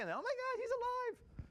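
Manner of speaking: high, amazed cry of joy; excited discovery. Retold or imitated. Apostle finding the tomb empty, amazed and overjoyed. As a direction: gasp it out like you just saw he lives.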